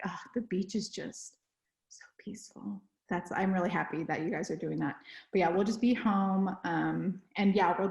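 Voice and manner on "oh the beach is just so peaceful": soft breathy sigh of longing; dreamy whisper. Pretend. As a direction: whisper it like you're picturing the beach. Dreaming about the beach.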